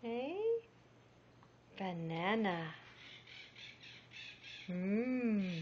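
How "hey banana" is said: ordinary delivery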